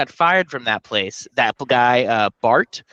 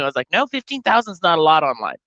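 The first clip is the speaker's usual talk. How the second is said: ordinary talk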